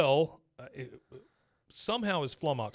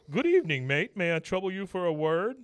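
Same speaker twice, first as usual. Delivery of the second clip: lightly voiced British penguin; British accent; 5 semitones higher, 4 dB louder than usual. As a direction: chipper posh puppet voice, raised. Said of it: "he goes".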